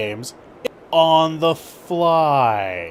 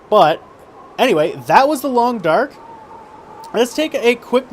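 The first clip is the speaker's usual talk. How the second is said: raised voice over wind noise; shouting over wind; 8 semitones higher, 5 dB louder than usual. Real, not acted. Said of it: "I'm shouting over the wind".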